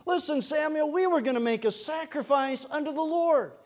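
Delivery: high-pitched, insistent self-defence; mock pleading justification. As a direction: plead your pious excuse, pitch well up. Saul acted pleading his excuse.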